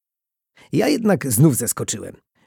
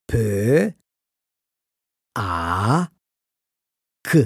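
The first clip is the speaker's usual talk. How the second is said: letter by letter, long pauses between; grave, careful sounding-out of letters; slow solemn spelling; slower than usual. A narrator's reading. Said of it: "Bardzo uroczyście i bardzo powoli przeliterowała".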